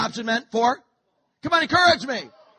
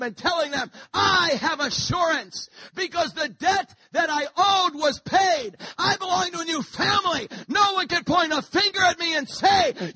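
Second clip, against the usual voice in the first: loud, higher, shouted preaching; preaching at full force; 4 semitones higher, 4 dB louder than usual. Acted. Preacher telling a crowd his debt is paid, shouting. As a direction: preach it, build the fire.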